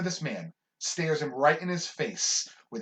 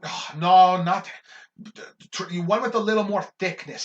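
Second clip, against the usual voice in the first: loud, higher picky instructions; fussy customer; 4 semitones higher, 7 dB louder than usual. Retold or imitated. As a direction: insist like a demanding regular. Customer dictating, being like.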